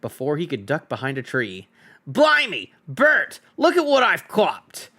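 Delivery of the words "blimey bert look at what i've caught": high, loud rough troll voice; troll William. Acted. Troll William shows Bert his catch, loud and high. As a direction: crow over the catch like a dim troll.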